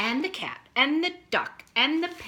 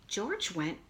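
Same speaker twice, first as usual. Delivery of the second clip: ordinary delivery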